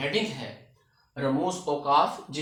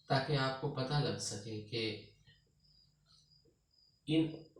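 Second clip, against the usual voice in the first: normal talking voice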